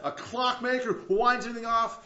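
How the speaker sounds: ordinary talk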